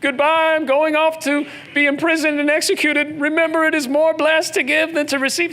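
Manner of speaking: cheery exaggerated goodbye from Paul; mock Paul farewell. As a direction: play Paul waving goodbye from the ship, over the top. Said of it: "For Paul, he's literally getting on a ship".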